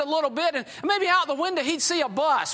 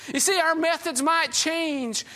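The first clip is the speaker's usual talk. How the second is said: ordinary talk, nothing marked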